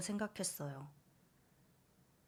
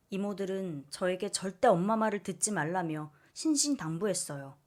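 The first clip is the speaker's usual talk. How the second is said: ordinary delivery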